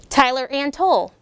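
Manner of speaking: lively storybook read-aloud voice; bouncy rhyming picture-book reading; reading to children. A narrator's reading. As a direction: read it like story time for second graders.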